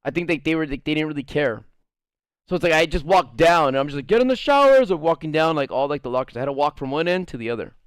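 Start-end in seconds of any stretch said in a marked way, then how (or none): 4.1-4.9 high, casual mock order; calling out. Aide walking the lockers, high call-out. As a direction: toss it down the locker row, pitched up.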